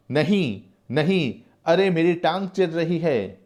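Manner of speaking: high, strained cry of pain. Acted. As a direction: cry out as if being torn apart. Prisoner screams under torture. (यातना से दर्द भरी ऊँची, चीखती पुकार)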